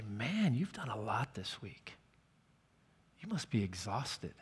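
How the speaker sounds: hushed, almost whispered sympathy; soft whisper. Retold or imitated. Counselor consoles a worn-out teen about his week, whispering. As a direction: drop to a gentle whisper of concern. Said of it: "she would say it like this".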